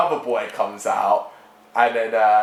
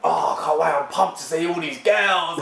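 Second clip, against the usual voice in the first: hyped-up producer voice; 6 semitones higher than usual. Retold or imitated. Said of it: "They're like".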